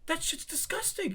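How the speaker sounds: high, soft, disgusted voice; mock disgusted. Narrator voices own disgust.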